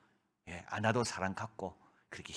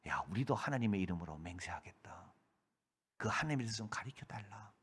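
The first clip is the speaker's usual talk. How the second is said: hushed plea; 4 semitones lower, 6 dB quieter than usual. Retold or imitated. Returning captives humbly ask.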